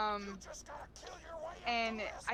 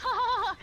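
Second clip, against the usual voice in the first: loud, high mock panic laugh; imitated nervous "ha" before bolting; panicked fake laugh; 12 semitones higher, 8 dB louder, faster than usual. Friend caught by the real Scott's coworker, panicked fake laugh.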